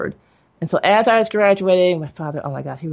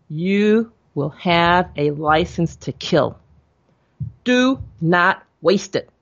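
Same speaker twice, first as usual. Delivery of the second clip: slow solemn father; much slower than usual. Retold or imitated.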